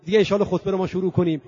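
ordinary talk, nothing marked

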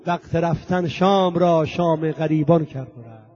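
impassioned verse declamation, easing toward the close; stirring devotional poem; poetry recitation. Acted. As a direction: recite each couplet with measured, ringing cadence. Recites a poem about Zainab.

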